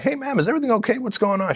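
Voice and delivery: high-pitched, politely checking in; concerned questioning. Retold or imitated. Passenger asking a flight attendant if all is okay, high-pitched concern.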